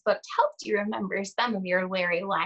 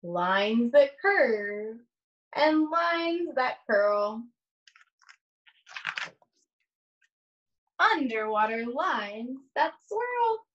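put-on slow, sing-song read-aloud to young children; lingering on each rhyme, long pauses between pages; story read aloud; 3 semitones higher, much slower than usual. Reads picture book aloud slowly.

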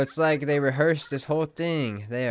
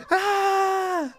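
sudden high shout; startled scream; 6 dB louder than usual. Real, not acted. Streamer startled as the boss battle begins, sudden high scream. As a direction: let out a sharp high yelp.

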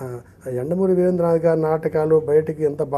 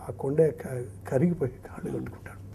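hushed climax; 8 dB quieter than usual. Acted. Climax of retold play.

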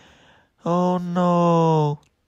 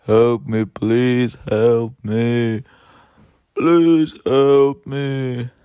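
fluttering cries for help; 6 semitones lower, slower than usual. Pretend. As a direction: lower pleading voice for help. Toy figure crying for help.

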